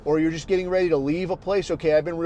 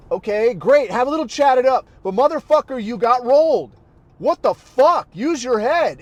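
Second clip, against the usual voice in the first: loud, higher exasperated scolding; angry rant; 6 semitones higher, 6 dB louder than usual. Real, not acted. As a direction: chew him out for being careless. Ranting at careless guy.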